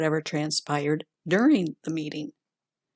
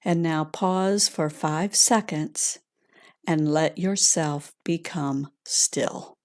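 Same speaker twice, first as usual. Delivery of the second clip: calm grounding exercise; 3 dB quieter than usual. Acted. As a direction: gently guide them inward, unhurried. Leads a heart meditation.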